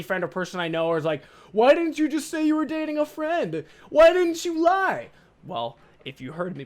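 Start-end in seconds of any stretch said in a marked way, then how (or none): 1.5-5.1 mocking friends.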